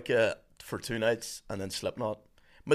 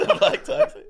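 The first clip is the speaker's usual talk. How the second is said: high, breathless laughing repeat; 4 dB louder than usual. Real, not acted. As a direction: repeat it while cracking up. Repeating joke through laughter.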